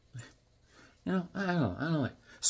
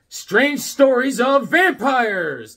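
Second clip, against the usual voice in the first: slow, higher horror-host title read; spooky announcer; 9 semitones higher, 7 dB louder, much slower than usual. Acted.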